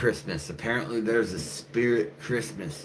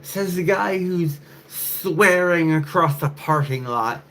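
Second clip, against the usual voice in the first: high, slow put-on woman's voice; woman from the parking lot, lightly mocked; mocking woman; 11 semitones higher, 5 dB louder, slower than usual. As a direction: sneer it as the stranger. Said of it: "she was like".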